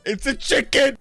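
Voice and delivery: very high, loud squeal; excited shriek. Pretend. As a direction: squeal it at a sudden sighting. Spots a chicken in game.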